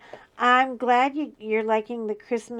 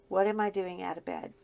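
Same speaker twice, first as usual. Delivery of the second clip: ordinary talk, nothing marked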